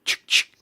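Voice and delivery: two loud, sharp cocking noises; imitated shotgun. Imitating the shotgun in the radio promo, sound effect. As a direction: mimic a shotgun being racked. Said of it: "shotgun go".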